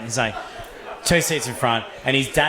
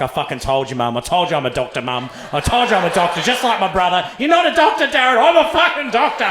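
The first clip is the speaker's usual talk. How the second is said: builds from usual voice to high, loud yelling; imagined vindicated son; 4 semitones higher, 3 dB louder than usual. Pretend. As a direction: act out a boast swelling into a mock shout. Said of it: "you could just tell in his mind".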